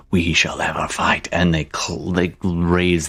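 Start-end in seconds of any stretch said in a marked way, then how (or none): 0.1-1.3 a hushed whispered chorus of devils echoing "We shall ever fight"; devils' whispered echo. Acted. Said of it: "all the devils echo that".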